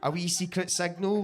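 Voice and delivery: ordinary talk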